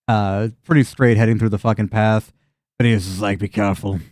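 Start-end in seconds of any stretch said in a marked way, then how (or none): 3.4-4.0 low, subdued farmer voice; understated, ominous warning, pitched down; simple farmer, warning. Acted. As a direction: caution the strangers gravely.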